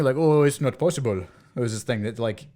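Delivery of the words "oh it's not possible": louder mock French-accented refusal; imitated French-Belgian manner. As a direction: stretch it with a Belgian French lilt. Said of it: "very French and Belgian".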